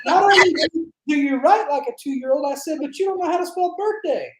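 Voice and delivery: high, sarcastic telling-off; retold sarcastic complaint about a misspelled cake; mocking scolding. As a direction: roast the baker's spelling, mock outrage.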